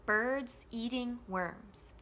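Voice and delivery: slow, even beats on BIRDS, EATING, WORMS; full stress on each of BIRDS, EATING, WORMS; content words stressed. Shown as a demonstration. Teacher isolating the stressed words, slow and evenly weighted. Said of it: "let's look at just the content words".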